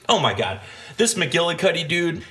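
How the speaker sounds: ordinary delivery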